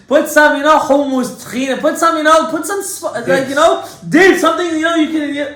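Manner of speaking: loud, high-pitched insistent pleading; high, loud, stumbling over the list; exasperated plea. Retold or imitated. Pleading with wife over salads.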